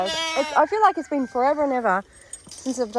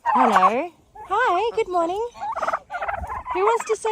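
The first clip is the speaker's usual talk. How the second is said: pet talk; 12 semitones higher than usual. Real, not acted. Cooing at the animals.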